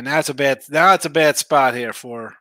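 ordinary talk

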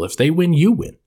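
rising, punchy and high; excited. Acted. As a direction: pitch it with a bright lift. Punchline of sponsor ad.

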